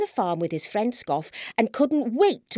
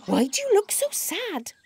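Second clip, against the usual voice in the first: high-pitched, concerned asking; lightly acted concern; concerned question; 11 semitones higher than usual. A narrator's reading. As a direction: ask a sad friend, voice lifted. Scoff asks his unhappy friend.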